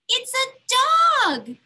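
high, loud and slow with delight; excited kids. Acted. Kids opening Mom's gift box, excited. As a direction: gasp it out like a thrilled child.

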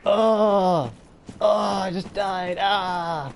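loud, high frustrated rant; raging gamer. Real, not acted. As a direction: vent at the game after dying. Dies again, rages at game.

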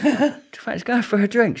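high, loud cheeky ask, laughing; laughing retelling. Retold or imitated. Client asking lawyer out for a drink, laughing. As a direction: crack up while quoting your own chat-up.

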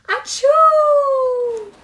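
huge high drawn-out sneeze; loud high sneeze. Acted. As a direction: let rip a long sneeze. Second sneeze in story.

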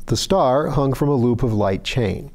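ordinary delivery, nothing marked

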